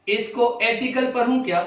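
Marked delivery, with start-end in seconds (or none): 0.6-1.1 "एटिकल" with the letter-name A as first vowel; mispronunciation. Shown as a demonstration. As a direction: say "एटिकल" opening on "ay", no r. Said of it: "एटिकल करना सही होगा".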